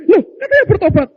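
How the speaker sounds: high, loud mock protest; indignant challenge. Acted quarrel with violent husband. (protes dengan nada tinggi)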